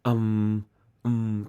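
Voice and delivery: "um" low and drawn out; demonstrated sound. Shown as a demonstration. Explaining a British R to cohost, soft low "um". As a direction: say "um" softly as a held murmur. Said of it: "is actually pronounced um".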